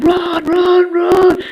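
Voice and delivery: high, loud squealing panic; panicked run. Acted. Turd and Fart fleeing Bartholomew, high panicked squeal. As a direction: scream it as tiny cartoon creatures fleeing. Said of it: "they're like".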